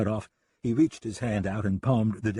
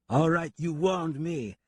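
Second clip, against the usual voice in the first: put-on slow, higher French-accented reply; strange French accent; 8 semitones higher, slower than usual. French gunman shrugs off deputy's warning, strange French accent. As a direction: concede mockingly in a French accent. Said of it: "said Raoul in his strange accent".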